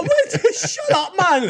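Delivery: laughing incredulous outburst; laughing disbelief. Retold or imitated.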